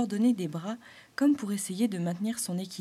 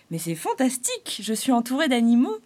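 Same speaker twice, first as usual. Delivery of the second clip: higher, louder amazed cry; delighted; 4 semitones higher, 8 dB louder than usual. Acted. Clown thrilled by the animals, higher and louder. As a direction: burst with joy at the animals.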